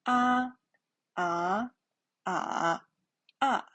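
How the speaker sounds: "Ah, ah, ah, ah" as level, rising, dipping, falling; four-tone drill. Shown as a demonstration. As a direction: run "ah" through the four Mandarin tones. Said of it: "you can repeat after my voice".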